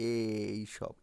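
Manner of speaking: "এই সব" with the opening এ stretched as a close e; drawn-out এ sound. Shown as a demonstration. Teacher showing pupils the vowel এ, drawn out. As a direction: stretch the e that opens "এই সব". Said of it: "এ আছে না?".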